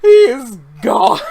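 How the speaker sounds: slow, loud, through laughter; laughing. Real, not acted. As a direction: barely get it out laughing. Laughing hard.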